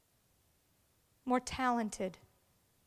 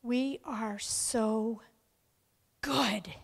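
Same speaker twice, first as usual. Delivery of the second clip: slow whisper; much slower than usual. Real, not acted.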